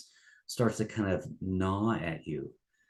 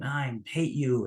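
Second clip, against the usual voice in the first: higher, slower, hammed-up "I hate you"; mock angry outburst; 5 semitones higher, slower than usual. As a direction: toss it out like a mock fight line. People's big blow-ups, fighting.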